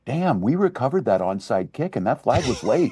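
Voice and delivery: reliving a late flag, barely holding it in; worked-up excitement. Retold or imitated. Governor retelling a game to host, excitedly laughing. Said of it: "I get a little bit excited".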